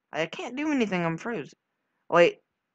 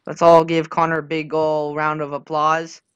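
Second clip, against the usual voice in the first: mock announcement; 16 dB louder than usual. Announcing applause sarcastically.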